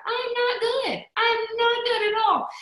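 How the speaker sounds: upset mock whine; whiny despair. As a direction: whimper it out like a hurt child. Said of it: "I'm like".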